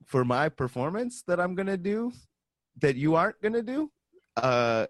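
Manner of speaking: high, loud heated pushback; arguing yell. Retold or imitated. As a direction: argue back hard about your instrument. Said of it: "it's back and forth, yelling".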